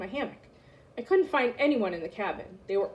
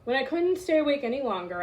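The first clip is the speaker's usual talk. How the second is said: usual speaking voice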